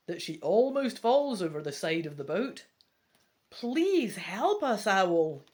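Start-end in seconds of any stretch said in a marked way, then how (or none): 3.5-5.4 loud, drawn-out plea; begging. Acted. Hero in runaway boat to the owl, begging. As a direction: beg for help, stretching the words slowly. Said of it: "you beg".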